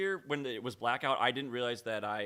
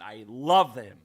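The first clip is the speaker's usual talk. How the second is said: much louder, swooning love; gushing; 10 dB louder, slower than usual. Real, not acted. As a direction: gush over him loudly. Gushing about the announcer.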